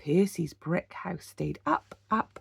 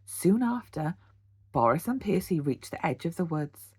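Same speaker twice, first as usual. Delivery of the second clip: plain reading voice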